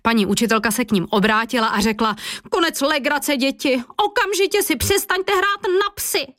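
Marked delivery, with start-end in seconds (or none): 2.5-6.3 high-pitched schoolmistress reprimand; scolding teacher. A narrator's reading. Teacher to children playing at dogs, scolding. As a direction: cut the fooling short, sharply high.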